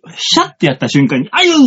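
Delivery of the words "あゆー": high fast cry of pain; mock groan. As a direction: squeal it like a hurt moan. Imitated pained groan.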